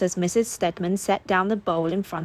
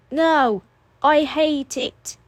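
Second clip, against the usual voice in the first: put-on defiant child; 8 semitones higher, 4 dB louder, much slower than usual. A narrator's reading.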